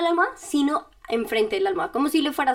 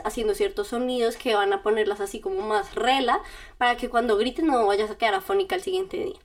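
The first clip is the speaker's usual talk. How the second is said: ordinary talk, nothing marked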